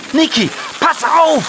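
very high, sharp warning cry; warning shout. Acted. Lolita warns her brother before the lily pad, high alarmed call. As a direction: call out to stop him.

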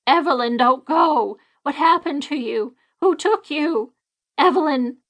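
put-on high, pleading calls of an old woman; desperate cry. A narrator's reading. Myrtle calling after her sister's ghost, desperate. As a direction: beg the vanishing ghost not to leave. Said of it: "Myrtle screamed".

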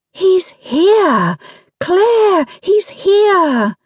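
high, loud, thrilled cries; a girl bursting with excitement at the window; excited girl. Acted. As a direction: call out in delight as the carriage arrives. Girl sees brother's carriage arrive.

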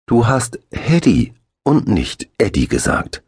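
put-on earnest child. A narrator's reading. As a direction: set the record straight. Eddie correcting Axel.

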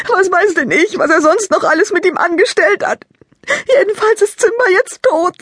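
put-on high, loud girl's voice thick with sobs; sobbing. Lila explains her brother's magic kit to Maike, sobbing. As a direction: sob through it like a heartbroken child.

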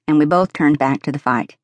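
plain reading voice

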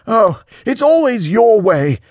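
high, loud, drawn-out bitterness; bitter. A narrator's reading. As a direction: throw it out as a bitter complaint. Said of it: "says Peter bitterly".

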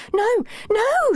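high, drawn-out cry of protest. A narrator's reading. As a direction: cry out in alarm, pitched right up. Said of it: "she cried".

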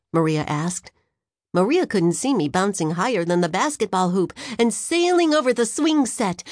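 ordinary delivery, nothing marked

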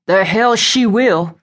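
slow, mildly booming anger; angry boom. A narrator's reading. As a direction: boom it out slowly in restrained anger. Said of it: "a voice boomed from the top".

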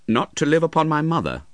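proud. Acted. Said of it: "his heart swelling as he spoke".

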